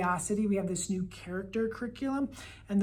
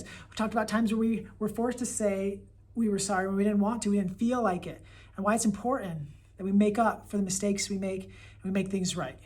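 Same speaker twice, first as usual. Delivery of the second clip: normal talking voice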